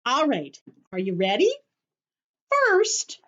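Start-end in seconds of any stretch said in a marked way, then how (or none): 2.5-3.2 much higher and a bit louder opener; eager start. Real, not acted. Teacher starting the craft for kids, eager high call. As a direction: kick off the first step with energy.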